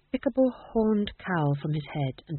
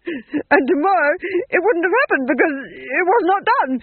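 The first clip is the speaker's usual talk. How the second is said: tearful; 10 semitones higher, 7 dB louder than usual. Acted. Duke raving about the murder.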